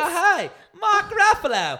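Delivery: very high, slow mock Australian accent; squealed, drawn-out Australian accent; Chris Hemsworth. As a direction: gush a greeting in exaggerated Australian. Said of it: "like, strong accent".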